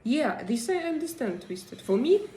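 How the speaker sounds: usual speaking voice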